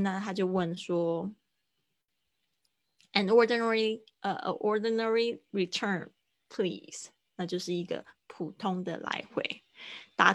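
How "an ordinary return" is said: normal talking voice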